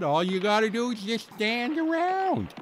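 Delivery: a hint of old Pa giving simple advice; quoting Pa. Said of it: "as Pa said".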